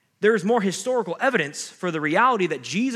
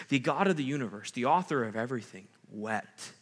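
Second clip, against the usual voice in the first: usual speaking voice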